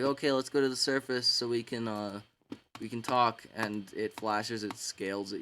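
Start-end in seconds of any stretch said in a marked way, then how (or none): 0.0-3.4 raised, louder eager call to swim up. Retold or imitated. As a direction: urge him upward, keen and loud. Dragon Tsunami urging Riptide upward.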